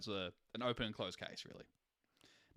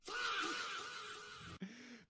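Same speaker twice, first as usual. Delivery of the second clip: exasperated groan; 8 dB quieter than usual. Real, not acted. Agonizing over a tier choice.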